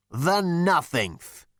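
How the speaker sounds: high, drawn-out scorn; spat contempt. A narrator's reading. Ancestor corrects his great-grandson, slowly spitting it out. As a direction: spit it out slowly as an old man. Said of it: "spat the figure".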